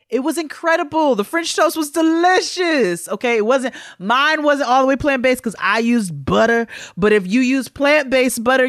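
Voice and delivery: high, loud and bubbly, with a laugh; excited gushing. Real, not acted. Host raving to listeners about plant-based French toast, excited. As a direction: rave about it like it changed your life.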